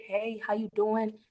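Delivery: calm matching greeting. Shown as a demonstration. Agent greeting a sleepy customer at the door, softly. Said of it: "You wanna do the same thing".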